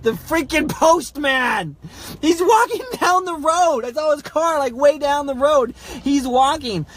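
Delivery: high, loud, drawn-out disbelief; loud, worked-up storytelling at full pitch; exasperated shouting. Real, not acted. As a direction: shout the punchline, stretching the words.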